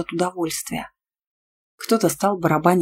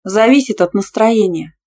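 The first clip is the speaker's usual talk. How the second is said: cheeky, loud, higher-pitched answer; brazen lie; 4 semitones higher, 6 dB louder than usual. A narrator's reading. Student lying to annoy Tima, cheekily. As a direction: toss it off brazenly to provoke. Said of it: "нагло соврала".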